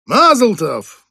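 loud, high festive shout; shouted toast. Acted. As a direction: call it out over the laughter. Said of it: "крикнул кто-то".

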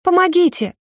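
high desperate cry for help; screaming for help. A narrator's reading. Grabbed girl screams to a passer-by, high desperate cry. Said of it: "Кричу ещё громче".

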